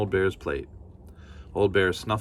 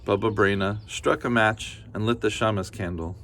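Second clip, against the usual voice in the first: ordinary delivery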